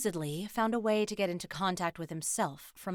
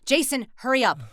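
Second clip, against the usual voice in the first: loud, raised, impatient shout; impatient call; 5 semitones higher, 6 dB louder than usual. Acted. Teammate calling Jason to hurry with the gear, impatient shout. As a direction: call out sharply to hurry someone.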